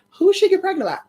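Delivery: high, pushy questioning; demanding question. Retold or imitated. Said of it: "So they were like".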